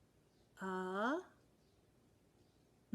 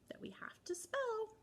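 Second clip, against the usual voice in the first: ordinary talk, nothing marked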